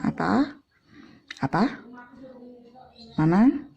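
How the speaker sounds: "Apa", "Mana" swept upward at the end; nada tanya. Shown as a demonstration. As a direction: lift "Apa" and "Mana" like a sharp question. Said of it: "atau seperti kata tanya". ("Apa", "Mana" dengan nada naik bertanya)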